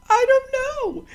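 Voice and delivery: put-on high, wailing little-girl "I don't know"; a child whining through tears, high and loud; whiny crying kid. Little girl answering her mother, wailing through tears.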